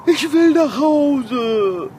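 slow, whimpering plea; scared child begging to go home; whiny fear. Acted. Scared stiff on tower top.

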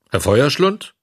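gruffly questioned title, lightly acted. A narrator's reading. Director rejecting how he was addressed, gruff. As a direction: throw the name back as a curt question. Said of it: "gab dieser barsch zurück".

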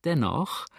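lightly amused, drawing out the suspense; hint of a sly smirk. A narrator's reading. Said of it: "schmunzelte er nach einer kleinen Pause".

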